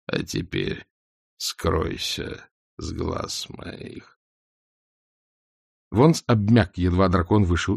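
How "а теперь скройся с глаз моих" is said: deep, hushed, very slow; dragon voice. A narrator's reading. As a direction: dismiss him, low and cold. Dragon dismisses, low.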